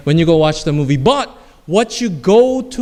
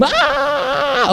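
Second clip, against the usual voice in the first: high-pitched held pretend scream; hammed-up scream of a sailor jumping off; mock scream; 8 semitones higher than usual. Director describes the side-view jump, mock scream. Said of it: "they're gonna hear your scream".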